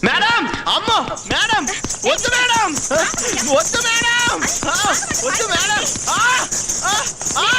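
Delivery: high-pitched cries for mercy; frantic, high wailing plea; panicked yelling. Acted. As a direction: beg to be let off, yelping. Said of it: "అరుస్తావేంటి".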